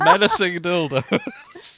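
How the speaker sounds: squeaky high laughter through the words; cracking up mid-phrase; laughing. Real, not acted. Repeating the phrase while laughing.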